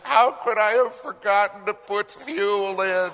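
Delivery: high, loud quote through laughter; hammed-up crying pilot, laughing. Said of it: "And he says".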